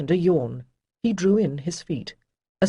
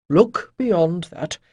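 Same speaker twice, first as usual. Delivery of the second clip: slow, louder, deliberate counsel; slow advice; 5 dB louder, much slower than usual. A narrator's reading. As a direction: draw it out to teach. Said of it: "Chayden advised".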